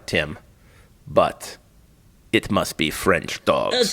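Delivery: low, slow, weighty caveat; French official. Retold or imitated. French official explains the catch to dog owner, grave. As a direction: imitate an official laying down the catch, slowly. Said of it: "is French, though".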